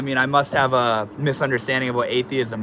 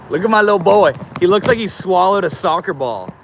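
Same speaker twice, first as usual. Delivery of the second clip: higher, louder doting voice to the pet; pet talk; 5 semitones higher, 5 dB louder than usual. Real, not acted. Streamer shows chat his round pet, doting pet voice.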